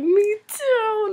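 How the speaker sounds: high, loud fangirl squeal; gushing agreement. Retold or imitated. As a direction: squeal it back to your crush. Gushing reply to "can't wait.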